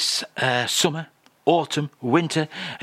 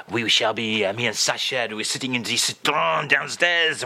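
[name]; 4 semitones lower than usual. Acted. French guest describes supermarket trip, mock [name]. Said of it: "Charles. Are you".

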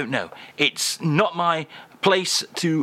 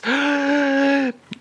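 much higher theatrical gasp; dramatic gasp; 12 semitones higher than usual. Acted. Host reveals translation, dramatic gasp. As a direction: gasp in mock awe.

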